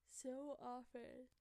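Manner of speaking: talking through a yawn; words stretched by a yawn. Real, not acted. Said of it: "that's a yawn".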